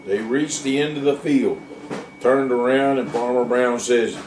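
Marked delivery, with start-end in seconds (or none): none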